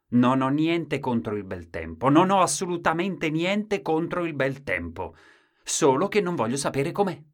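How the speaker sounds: high, loud, insistent protest. Acted. Husband insists, rising.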